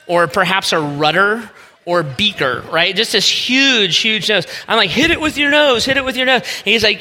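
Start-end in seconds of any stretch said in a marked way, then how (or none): 4.9-6.4 mocking taunt. Pitcher teases the big-nosed hitter, high mocking taunt. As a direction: rib him from the mound, pitched way up. Teasing batter across the field.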